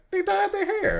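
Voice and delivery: a sudden high squeaky mock voice; mock high squeak. A friend mocking a bystander, in a squeaky high voice. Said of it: "They're just gonna be like".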